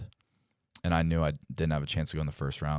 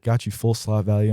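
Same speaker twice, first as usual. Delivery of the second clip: agent's good news; 5 semitones higher, 7 dB louder than usual. Retold or imitated. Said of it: "He's like".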